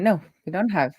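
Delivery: usual speaking voice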